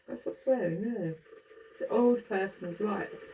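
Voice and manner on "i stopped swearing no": ordinary talk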